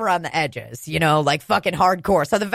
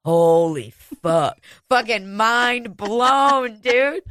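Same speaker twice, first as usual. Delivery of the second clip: mind blown; 3 semitones higher, much slower than usual. Retold or imitated. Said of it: "Sophia in that moment was a hundred percent like".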